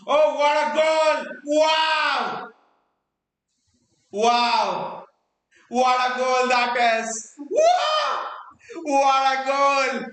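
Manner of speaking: high, stretched-out shouts of wow; long goal yell. Real, not acted. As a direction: roar the goal out, drawn long. Goal scored during live commentary.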